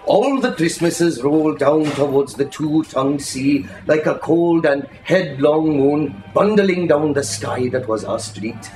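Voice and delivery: usual speaking voice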